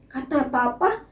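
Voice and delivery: normal talking voice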